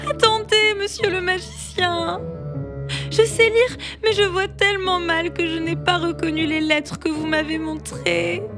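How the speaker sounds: high, frightened pleading; high tearful plea of a small mole; pleading little mole. Acted. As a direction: beg the magician to listen.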